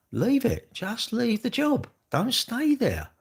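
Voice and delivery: high-pitched, insistent plea; exasperated, pleading advice; emphatic urging. Real, not acted. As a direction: urge them hard, pitch right up. Urging unhappy workers to quit.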